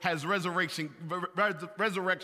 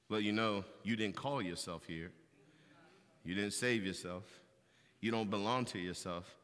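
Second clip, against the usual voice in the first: much softer, lower, quiet rebuke; gentle wife; 9 semitones lower, 8 dB quieter than usual. Retold or imitated. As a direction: remind him kindly where he belongs. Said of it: "Then she'll say something like".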